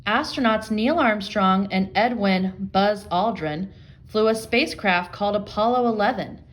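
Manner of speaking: ordinary talk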